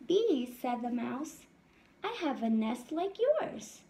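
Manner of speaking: lively storybook read-aloud voice; reading to children. A narrator's reading. Teacher reading a picture book to her class, lively. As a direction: read it out brightly to the little ones.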